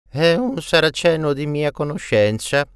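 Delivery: lifted, projecting voice of an abbot; abbot explaining. A narrator's reading. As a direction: announce the guest to the whole table. Abbot explains guest aloud to company.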